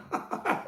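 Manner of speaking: soft, breathless chuckle; laugh. Real, not acted. Amused after teasing a viewer, quiet laugh.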